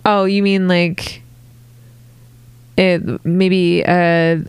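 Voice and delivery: ordinary talk, nothing marked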